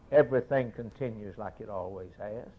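ordinary talk

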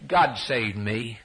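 normal talking voice

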